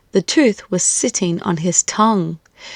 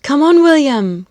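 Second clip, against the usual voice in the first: louder, higher hurry-up call; 6 semitones higher, 5 dB louder than usual. Acted. Mum hurrying her son at bedtime, calling. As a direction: chivvy him along from the next room. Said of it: "cried Mum at bedtime".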